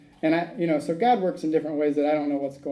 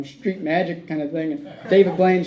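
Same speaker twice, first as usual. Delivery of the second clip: usual speaking voice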